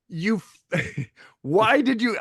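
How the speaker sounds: exasperated sputter. Retold or imitated. Retold argument, exasperated.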